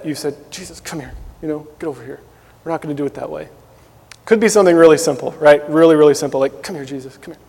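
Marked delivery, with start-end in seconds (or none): 0.5-3.5 hushed coaxing. Retold or imitated. Disciple quietly beckoning Jesus to step aside, hushed and sly. 6.6-7.4 hushed coaxing. Retold or imitated. Disciple quietly beckoning Jesus to step aside, hushed and sly.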